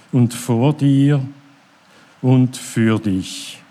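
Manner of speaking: solemn prayer recitation; prayer reading. Acted. Reads a prayer aloud.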